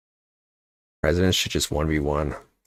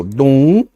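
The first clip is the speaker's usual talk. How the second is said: cartoon sound effect; 8 semitones higher, 9 dB louder than usual. Imitates a Donkey Kong jump sound.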